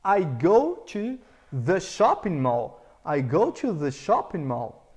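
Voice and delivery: slow, careful "I go to the shopping mall"; slow correct English model. Shown as a demonstration. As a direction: model it slowly for students. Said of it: "pra você usar o go to".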